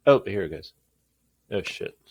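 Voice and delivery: ordinary talk, nothing marked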